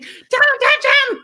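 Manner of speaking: very high, loud, drawn-out slow yell; shrieking child. Retold or imitated. Son yelling at Mom not to touch the elf, very high, loud and slow. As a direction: howl it like a frantic little boy.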